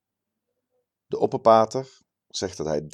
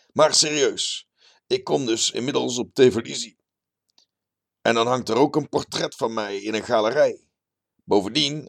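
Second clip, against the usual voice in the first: higher, louder show-off character voice; pleased-with-himself bragging man, pitched up; boastful terrace biologist; 5 semitones higher, 5 dB louder than usual. A narrator's reading. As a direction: brag like a puffed-up local celebrity.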